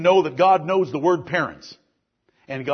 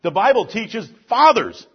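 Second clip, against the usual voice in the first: preaching at full force; 3 semitones higher than usual. Acted. Pastor preaching at full force to the men of the congregation. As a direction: preach it hard, building to a roar.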